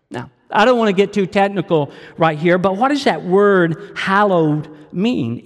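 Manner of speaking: ordinary talk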